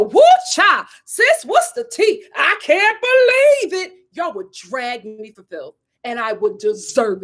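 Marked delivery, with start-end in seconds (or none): 0.0-3.9 high, slow, loud gossip; hammed-up sassy gossip. Mocking herself on TV as sassy gossip. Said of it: "y'all watching me go".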